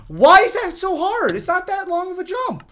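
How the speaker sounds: high, loud exasperated yell; frustrated outburst. Real, not acted. Gamer failing an easy jump, frustrated high yell. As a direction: cry out in sheer exasperation.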